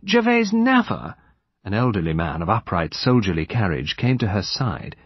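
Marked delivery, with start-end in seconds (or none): 0.1-1.1 high wail, left unfinished; distressed lady's high-pitched voice. A narrator's reading. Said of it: "wailed Lady Chevenix Gore".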